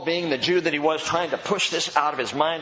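ordinary talk

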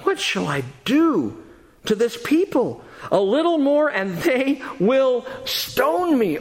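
high-pitched, exasperated plea to God; crying out. A narrator's reading. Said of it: "He cried out to the Lord".

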